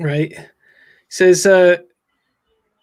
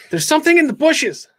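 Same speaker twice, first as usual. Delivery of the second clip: alarmed; 10 semitones higher, 3 dB louder than usual. Acted. Scared kid spots something in bushes.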